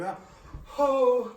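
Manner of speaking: loud, high mock moan; hammed-up "ah, ohh"; mock groan. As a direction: imitate a friend collapsing, groaning. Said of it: "he's just going".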